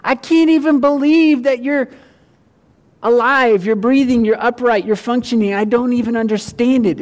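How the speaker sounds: high, loud amazement at a friend; amazed disbelief. Retold or imitated. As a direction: marvel that they're still standing. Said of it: "and go".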